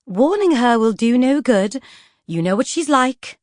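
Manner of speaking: a patient lady's sigh of reason; placid Lady Feroza. Acted. Lady Feroza reasoning with Poisons, calm and genteel. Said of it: "Lady Feroza replied placidly".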